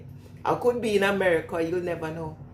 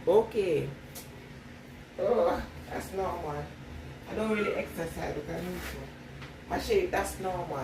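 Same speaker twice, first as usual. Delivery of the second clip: usual speaking voice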